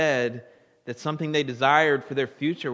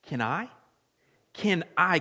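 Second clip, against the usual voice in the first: usual speaking voice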